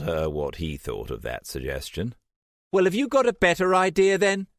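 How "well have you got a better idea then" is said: fast, high, loud snapped challenge; much higher and louder, rushed angry retort. A narrator's reading. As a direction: fire it back at him. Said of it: "Trish said angrily".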